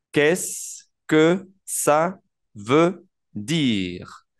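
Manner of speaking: extremely slow, long pause after each word. Shown as a demonstration. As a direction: stretch out every word for beginners. Teacher breaks the phrase down.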